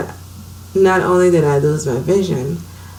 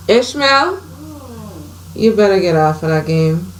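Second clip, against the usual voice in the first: raised voice calling across the house; calling out to son; 3 semitones higher than usual. Real, not acted. Mother calls her son off his video game, raised voice. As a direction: call him off the game from the next room.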